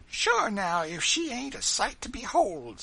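high-pitched, loud dialect exclamation; compassionate old servant. Acted. Old Dinah over the fainted woman, compassionate. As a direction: exclaim with pity, high and folksy. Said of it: "said old Dinah compassionately".

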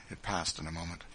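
usual speaking voice

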